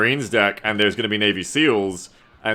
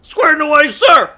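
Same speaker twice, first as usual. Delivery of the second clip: high barked soldier shout; loud gung-ho soldier; 12 dB louder, slower than usual. Retold or imitated. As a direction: yell it like a drill-ready grunt. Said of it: "the most fucking Moto Marine voice actor performance".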